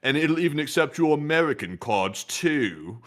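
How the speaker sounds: mock the attendant's sarcasm, drawn out; sarcastic tone. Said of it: "sarcastic tone behind it".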